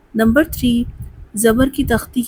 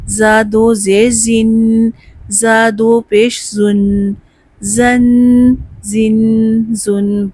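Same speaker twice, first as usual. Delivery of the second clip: slow, spelled-out drill for students to repeat; slow drill recitation; much slower than usual. Shown as a demonstration. Teacher leading a spelling drill, slow. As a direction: chant each syllable slowly.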